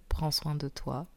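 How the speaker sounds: usual speaking voice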